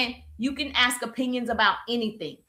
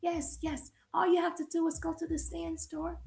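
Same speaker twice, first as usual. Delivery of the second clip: quiet introvert demo; 3 semitones higher, 10 dB quieter than usual. Shown as a demonstration. Coach showing viewers how introverts talk, very soft. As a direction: shrink the voice down to a reserved murmur. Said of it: "You talk like this".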